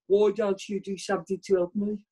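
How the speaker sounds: Oliver Hardy slipping into Brummy; unintended Brummy accent impression; botched Brummy accent. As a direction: attempt Hardy and land in Brummy. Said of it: "him into a Brummy".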